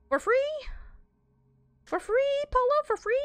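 very high loud disbelieving squeak; incredulous squeal. Real, not acted. Disbelief at teammate sleeping.

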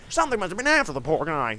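radio voice. Pretend. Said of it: "I need to get the voices right".

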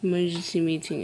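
ordinary talk, nothing marked

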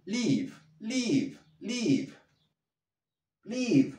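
live repeated high, short and sharp; live agudo; drilled live. Shown as a demonstration.

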